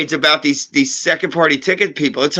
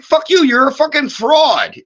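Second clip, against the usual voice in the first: angry outburst; 7 semitones higher than usual. Retold or imitated. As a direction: spit it at the scammer. Venting at fake accounts.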